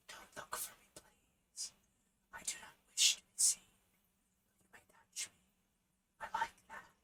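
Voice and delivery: airy, breathy wind spirit. Acted. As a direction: whisper it like wind. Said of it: "that really breathy voice".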